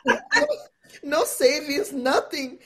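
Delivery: laughing disbelief. Real, not acted. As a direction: laugh at your own daring. Laughing at own recklessness.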